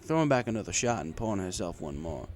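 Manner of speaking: ordinary delivery, nothing marked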